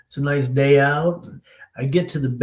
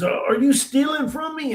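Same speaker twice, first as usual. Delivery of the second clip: high-pitched retold accusation; mock outraged store owner; accusing. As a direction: confront a thief, voice jumping up. Store owner confronts a young thief.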